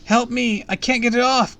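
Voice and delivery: put-on very high, loud child's cry; shrieking for rescue; panicked scream. A narrator's reading. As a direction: scream as if trapped and desperate. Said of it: "Colin screamed".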